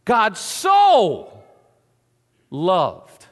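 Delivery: "so" loud and stretched; preacher lands hard on "so"; big loud "so"; stress on so. Shown as a demonstration. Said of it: "S O, means so much".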